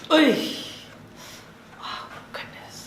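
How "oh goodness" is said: whispered. Real, not acted. Quiet reaction after adjustment.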